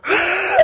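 high, sharp intake of breath; imitated shocked gasp. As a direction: gasp as the horrified waitress. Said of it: "And then she just went".

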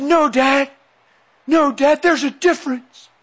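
high-pitched imitated son objecting; upset protest. Son contradicting his preaching dad, imitated high protest. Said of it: "screaming at me".

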